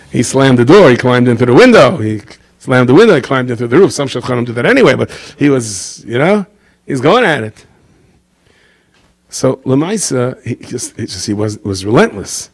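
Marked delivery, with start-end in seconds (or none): none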